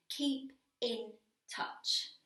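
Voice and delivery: each word set apart, slow and loud; slow, deliberate pauses between the three words. Acted. Woman stressing his three simple words, word by word.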